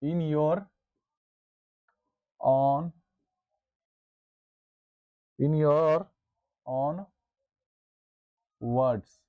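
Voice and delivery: slow, word-by-word dictation pace. Shown as a demonstration. Teacher dictating a definition to the class, slow and deliberate. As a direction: dictate each word slowly so students can write. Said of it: "teacher dictating definition for notes".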